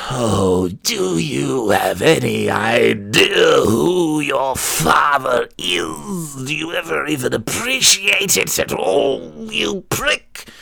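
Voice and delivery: slow, swooping mock wail; old church broad. Old blue-haired woman accosting the son, mock yelling. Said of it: "some old blue-haired broad would be like".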